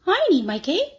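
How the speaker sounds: a hint of a pleading little girl. Annie holding on to her cape.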